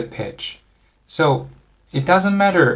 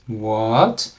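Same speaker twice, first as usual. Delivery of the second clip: "what" stretched and swept upward; second-tone rise; 2 semitones lower than usual. Shown as a demonstration. Teacher points out the second tone in English, "what" rising. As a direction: draw "what" up like a question. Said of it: "has the second tone's feature".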